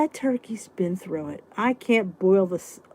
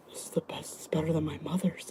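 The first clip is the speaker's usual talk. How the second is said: hushed, breathless praise; whispered husband quote; 4 semitones lower, 11 dB quieter than usual. Retold or imitated. Husband raving about her soup, whispered. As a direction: whisper it like a secret compliment. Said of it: "He's like".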